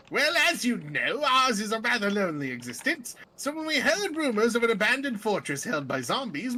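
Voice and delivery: put-on genteel undead emissary with British accent. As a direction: recount it grandly in a British accent. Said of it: "I gave him a British accent".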